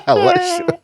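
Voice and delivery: high squealing laugh through the name; cracking up, voice jumping way up high. Real, not acted. Host cracking up reading a chat name, squealing with laughter. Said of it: "Anthony Alashua".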